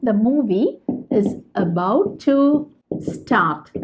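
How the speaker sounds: drawn-out, word by word; slow model sentence. Shown as a demonstration. Teacher showing learners the English sentence, very slow. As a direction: dictate it slowly to the class. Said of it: "teacher models sentence".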